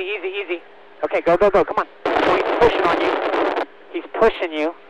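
usual speaking voice

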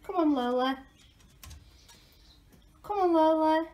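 loud, high call across the garden. Real, not acted. Owner calling Lola the pet to come, loud and high. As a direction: call her over from a distance.

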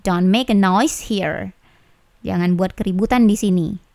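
usual speaking voice

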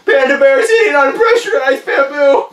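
high, loud, tearful wail; panicked self-soothing. Acted. Balloon blower lists happy thoughts, wailing in terror. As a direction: cry out comforting thoughts in sheer dread.

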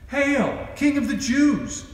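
high, loud mock salute; mocking hail. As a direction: jeer it up high like mocking soldiers. Soldiers mocking Jesus.

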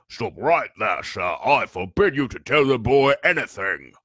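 loud, blustering uncle; commanding. A narrator's reading. Uncle forbids the giant to tell, commanding. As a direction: forbid it forcefully. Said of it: "he commanded".

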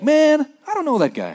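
high, offhand slangy brush-off; modern casual denial. Retold or imitated. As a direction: toss it off like a modern guy. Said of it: "today we'd be like".

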